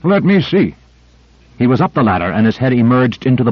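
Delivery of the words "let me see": higher, urgent call to look; eager cry. A narrator's reading. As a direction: cry out, pushing to see. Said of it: "Duval cried".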